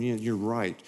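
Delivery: mild agreeing murmur. Retold or imitated. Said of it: "you're going".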